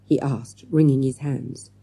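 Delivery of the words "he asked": ordinary delivery, nothing marked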